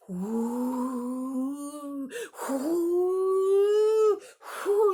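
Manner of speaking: loud blowing whoo; wind howl. Shown as a demonstration. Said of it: "Are you ready, wind".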